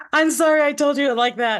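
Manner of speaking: high, loud mock-distressed apology; exaggerated high-pitched sorry, acted out; imitated frantic apology. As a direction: blurt it out like a panicked doctor. Doctor apologizing after she cried.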